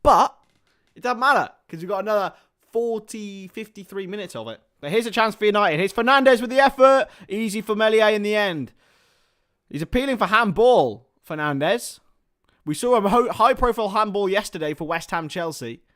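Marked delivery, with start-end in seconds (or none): 4.8-15.8 loud, high play-by-play call; commentator voice. Acted. Streamer at the TV, loud mock commentary.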